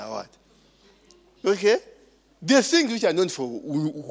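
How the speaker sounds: usual speaking voice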